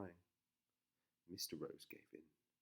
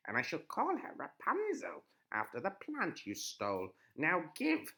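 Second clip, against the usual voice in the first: high, loud witch; commanding old witch; 8 semitones higher, 7 dB louder than usual. A narrator's reading. Witch voiced.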